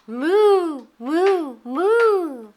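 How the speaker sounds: playful mooing; cow moo. Shown as a demonstration. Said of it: "Let's go moo together".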